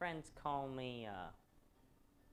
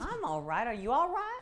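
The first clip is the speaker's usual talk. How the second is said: concerned asking back; 12 semitones higher, 11 dB louder than usual. Acted. Vin checks on curator.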